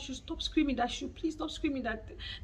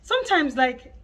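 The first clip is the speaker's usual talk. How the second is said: ordinary talk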